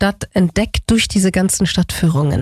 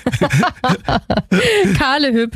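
laughing; 4 semitones higher than usual. Real, not acted. Host laughs at guest's joke.

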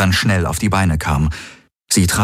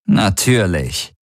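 gedehnte, höhnische Zustimmung; sarkastisch; slower than usual. A narrator's reading. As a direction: langsam und ungläubig zustimmen. Tari glaubt Kirai höhnisch nicht. (sarkastisch gedehnt)

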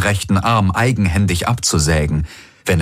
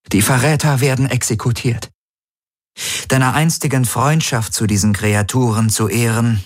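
higher, detached voice over the comm; cold commander; 6 semitones higher than usual. A narrator's reading. Ylana informs Garlin over the comm of the execution, coolly. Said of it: "Ylanas Stimme drang aus dem Komm".

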